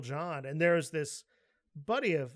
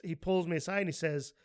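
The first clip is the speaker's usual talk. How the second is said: ordinary talk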